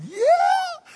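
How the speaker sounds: high, loud, laughing yell of delight; cheering along with the boys, laughing and loud; laughing shout. Retold or imitated. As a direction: whoop it out with a laugh. Sons cheer answered.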